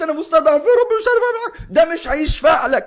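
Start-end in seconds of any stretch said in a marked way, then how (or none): 0.0-1.7 mock helpless plea. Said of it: "حتى لو قلت".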